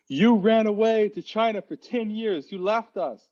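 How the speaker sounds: high accusing sister voice; quoting sister. Retold or imitated.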